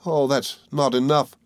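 plaintive, understated cry. A narrator's reading. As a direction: object with mild distress. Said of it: "she cried".

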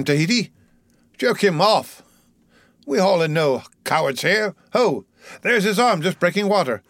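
put-on high-pitched, excitable ship's boy; crazed Pip. A narrator's reading. Mad Pip raves that Pip jumped from the whaleboat, crazed.

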